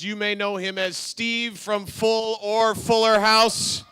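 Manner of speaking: loud, drawn-out hype announcement; announcer intro. Acted. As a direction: build up to the guest's name. Host introduces guest.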